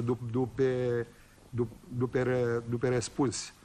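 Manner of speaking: put-on old man stammering his sharp comeback to Suca. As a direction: drag the stammer out before the punchline. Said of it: "moșul bâlbâi la vorbă".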